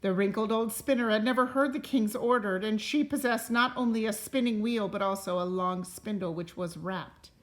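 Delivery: unhurried read-aloud voice, rising on the opening lines; storybook narration. A narrator's reading. Parent reading a fairy tale to a child, gentle storytelling. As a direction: tell it like a bedtime story to a child. Reads a picture book aloud.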